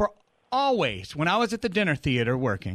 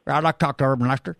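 Southern twang caller; 3 semitones lower, much faster than usual. Retold or imitated. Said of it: "now got the twang".

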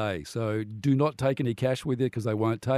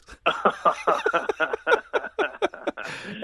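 real high peals of laughter; long helpless laugh; 10 semitones higher than usual. Real, not acted. Host telling of his thrifty dad, long laugh. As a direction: laugh long and high at your own story.

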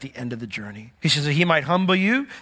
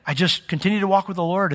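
usual speaking voice

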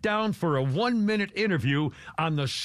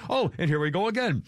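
ordinary talk

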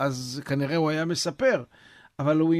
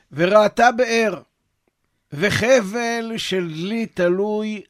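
slow, loud storyteller reading; slow, loud text reading; 3 semitones higher, 6 dB louder, slower than usual. Acted. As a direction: read the old tale slowly and loudly, line by line. Reads the source story aloud.

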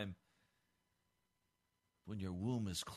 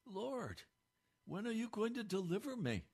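pleading prayer; 9 semitones higher than usual. Retold or imitated. Quoted pleading prayer to God.

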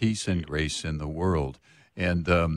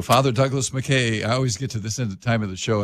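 normal talking voice

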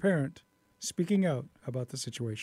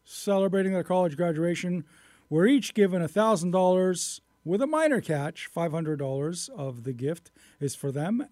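measured radio news delivery; news reader introducing a clip; 3 semitones higher, 4 dB louder, faster than usual. Acted. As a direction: read it out as a radio news story. Reads a news story.

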